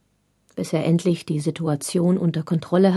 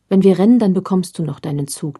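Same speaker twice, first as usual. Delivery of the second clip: plain reading voice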